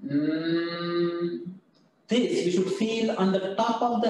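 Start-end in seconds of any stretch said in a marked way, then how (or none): 0.1-1.5 low, sustained closed-mouth "mmm" hum; steady humming sound. Shown as a demonstration. Teacher demonstrates the ma sound to class, steady low hum. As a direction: hum a long, even Mm. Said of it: "the humming sound".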